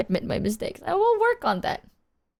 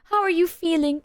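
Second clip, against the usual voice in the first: higher, gentle mothering voice; 8 semitones higher than usual. Retold or imitated. Mom checking on her child over a minor thing, gently cooing. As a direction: coo it like an anxious mother. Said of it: "she's like".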